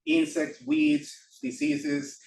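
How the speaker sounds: ordinary talk, nothing marked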